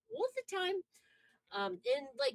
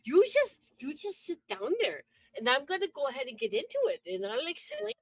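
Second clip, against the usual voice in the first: put-on playful Wisconsin accent; 5 semitones higher, 6 dB louder than usual. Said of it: "Wisconsin accent".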